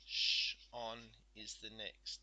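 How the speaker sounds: Sh-on drawn out very slowly; slow syllable. Shown as a demonstration. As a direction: stretch out the syllable Sh-on. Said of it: "so it's S T A is one".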